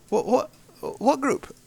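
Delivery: high-pitched, baffled retort; incredulous. Real, not acted. Man disputing a claim that he ran a group, incredulous. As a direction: pitch leaps up in disbelief.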